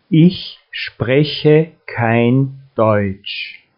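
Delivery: ordinary delivery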